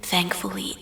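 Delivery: ordinary delivery, nothing marked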